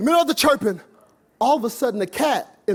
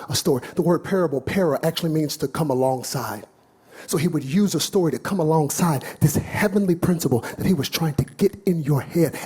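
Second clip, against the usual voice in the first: ordinary talk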